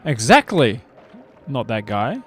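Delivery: ordinary delivery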